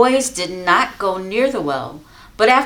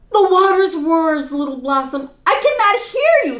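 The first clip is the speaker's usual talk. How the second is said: distracted mother; 8 semitones higher, 3 dB louder than usual. Mother can't hear over water.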